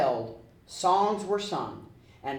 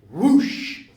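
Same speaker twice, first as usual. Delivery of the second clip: whoosh sound; 4 semitones higher, 8 dB louder than usual. A narrator's reading. Vanishing sound.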